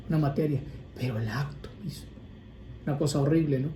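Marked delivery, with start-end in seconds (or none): 1.0-2.0 whisper. Real, not acted. Priest pausing on a grave point.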